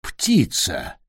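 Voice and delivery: low creaky exclamation. A narrator's reading. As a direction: croak it out low and rough. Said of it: "скрипучий голос".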